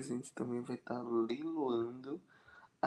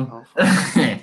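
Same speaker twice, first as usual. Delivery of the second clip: shouted; 19 dB louder, slower than usual. Acted. Shouts the place name jokingly.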